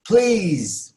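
high-pitched call; exclamation. Shown as a demonstration. Teacher models knocking on a door, exclaimed. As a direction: cry out at the door. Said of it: "Bunu haykırarak söylüyorsunuz".

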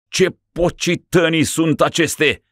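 high, startled shout. Acted. Sick ruler shouting at the monks. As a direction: cry out at the strange figures. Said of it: "strigă".

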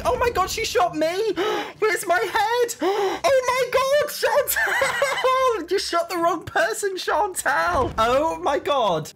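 high, loud shrieks broken by gasps and laughter; shocked screaming. Real, not acted. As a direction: scream in hysterical disbelief at a betrayal. Shot by her ally in game.

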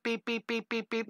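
soft, high little beeps; playful beeps. Shown as a demonstration. Teacher leading kids in a silly beep. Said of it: "somebody say beep".

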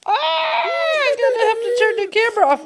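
high loud pretend shriek; mock scream. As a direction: squeal as if chased. Mother mock screaming at monster.

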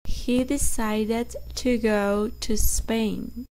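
very slow, each word spaced for repeating; slow model sentence. Shown as a demonstration. Teacher dictating slowly for students to repeat. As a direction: read it slowly so students can echo every word. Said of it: "Repitan después de mí".

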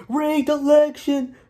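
slow, high drawn-out mocking whine; mocking sore loser. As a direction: stretch it out slowly in a high put-on whine. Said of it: "going all over social media saying".

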